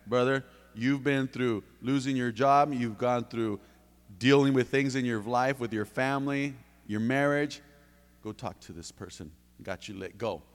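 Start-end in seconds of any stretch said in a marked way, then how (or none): none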